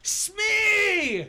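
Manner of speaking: high, loud call. Acted. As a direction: shout the name across the deck. Calling out to a crewman.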